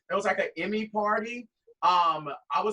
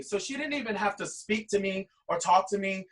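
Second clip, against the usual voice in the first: usual speaking voice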